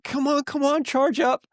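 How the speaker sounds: high, pleading urge at a dead phone; impatient urging. Retold or imitated. To his phone, waiting on a charger: high, impatient urging. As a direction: come on, hurry, willing it to charge.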